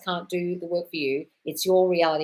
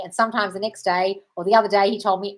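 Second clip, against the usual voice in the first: ordinary talk, nothing marked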